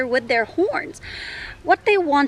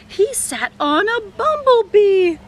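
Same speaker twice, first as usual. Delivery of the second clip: dramatic surprise; 9 semitones higher, 5 dB louder than usual. A narrator's reading. Dramatic story moment.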